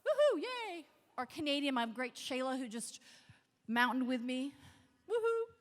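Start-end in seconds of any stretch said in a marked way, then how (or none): 0.1-0.8 high whooping cheer. Real, not acted. Cheering the crowd. 5.1-5.5 high whooping cheer. Real, not acted. Cheering the crowd.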